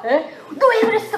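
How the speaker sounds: high, slow, laughing scold; mock scolding. Preacher acts out people scolding themselves, high and laughing. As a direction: scold yourself half in jest. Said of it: "막 이러고".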